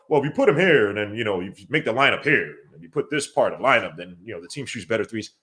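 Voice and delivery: mock lineup critic. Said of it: "I wanna hear no".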